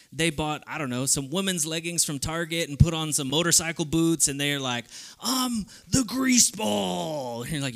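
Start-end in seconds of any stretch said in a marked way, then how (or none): 5.2-7.4 slow, drawn-out shouted wrestler boast; hammy wrestler announcement. Retold or imitated. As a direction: ham it up like a cheesy ring persona. Wrestler announces his persona.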